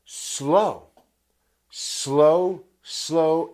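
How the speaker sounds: each "Slow" drawn out and leaned on; emphatic slow. Shown as a demonstration. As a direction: hammer home the word "Slow". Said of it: "I can't emphasize enough".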